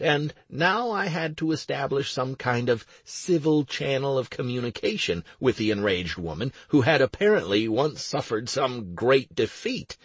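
ordinary delivery, nothing marked

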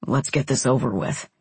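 low, grumbled male voice; grumbling. A narrator's reading. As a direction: grumble it reluctantly. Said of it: "he grumbled".

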